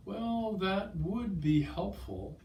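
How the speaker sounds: high, drawn-out, hedging reply; noncommittal. Acted. Customer answering hairdresser's shampoo suggestion, noncommittal. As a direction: stall politely on a high, slow note.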